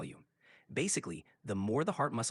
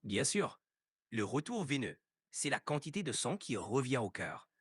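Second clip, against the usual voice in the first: speaking French with a native-style French accent. Shown as a demonstration. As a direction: switch fully into French, French accent. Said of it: "answer to me in a French accent".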